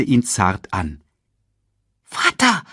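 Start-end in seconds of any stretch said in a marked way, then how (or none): 2.0-2.6 put-on high, eager child's call; eager cub. A narrator's reading. Cub tries waking his father at his ear, eager high call. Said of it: "hüstelte er eifrig in Mufasas Ohr".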